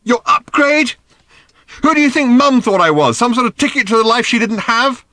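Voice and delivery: high, loud, accusing questions; angry demand. A narrator's reading. Daughter confronts her father about Mum, shouting angrily. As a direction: throw each question at him furiously. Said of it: "she demanded angrily".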